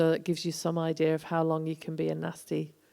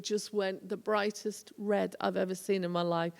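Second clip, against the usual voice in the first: ordinary delivery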